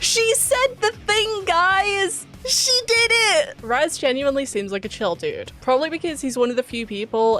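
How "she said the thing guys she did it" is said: high, slow, delighted squeal; excited squeal. Real, not acted. Thrilled the character said it.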